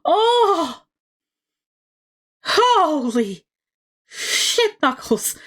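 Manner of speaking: high, loud surprised oh; real jolt of surprise; reacting to a brutal moment; shocked. Real, not acted.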